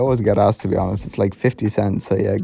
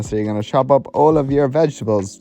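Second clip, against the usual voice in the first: ordinary delivery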